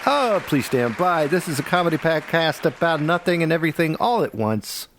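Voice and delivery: show announcer. Pretend. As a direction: roll out the show intro like an announcer. Host reads the show intro.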